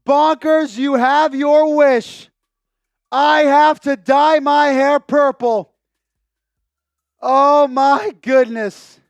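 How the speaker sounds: loud, drawn-out astonished exclaiming; loud disbelief. Real, not acted. Host told he must dye his hair, loud disbelief. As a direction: holler slowly in real stunned disbelief. Donation means dyeing hair purple.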